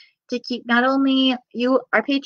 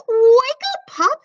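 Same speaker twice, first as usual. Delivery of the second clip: higher, louder bright wake-up call; cheery piglet voice; 13 semitones higher, 5 dB louder, slower than usual. Acted. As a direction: call like an eager little pig. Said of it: "says Prissy".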